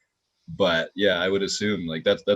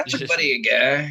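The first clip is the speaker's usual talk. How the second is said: hammed-up Canadian accent, louder; mock Canadian accent; 9 semitones higher, 5 dB louder than usual. Showing off a Canadian accent for the hosts, hammed-up Canadian. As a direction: play the cheery Canadian with a Canadian accent. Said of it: "my Canadian accent".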